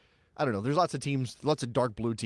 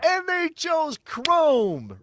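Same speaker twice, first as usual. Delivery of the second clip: incredulous; 7 dB louder, much slower than usual. Real, not acted. Disbelief at the chrome choice.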